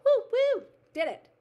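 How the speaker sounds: high, loud triumphant whooping; excited high-pitched cheer; celebratory whoop. Real, not acted. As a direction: cheer a small victory at full voice. Cheering own small success.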